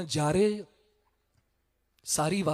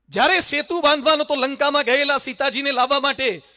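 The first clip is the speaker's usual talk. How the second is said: ordinary talk, nothing marked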